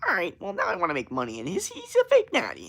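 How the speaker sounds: piercing high-pitched impression, quick then shrieking; Greg Doucette. Fitness YouTuber chasing money in his video, mimicked in a high voice. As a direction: climb from high, hurried chatter into a squeal. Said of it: "that's what he sounds like".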